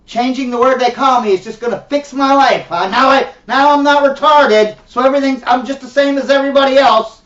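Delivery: loud, high mock outrage; indignant sarcasm. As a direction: build to a sarcastic shout in the offended person's voice.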